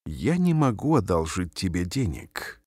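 usual speaking voice

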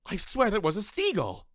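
high, loud astonished cry. Acted. Anthony, watching the stranger's roll, crying out loud and high. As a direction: shout it in disbelief. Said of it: "he said out loud".

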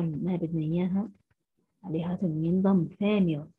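usual speaking voice

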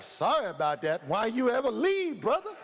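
mock outrage at the pilot, rising to a squeak on brother; incredulous echo. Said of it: "I'm like".

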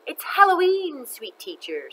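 mock-sweet menace to the teacher; taunting ghost teacher. Ghost teacher taunts Missus Jules.

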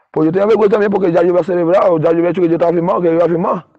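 normal talking voice

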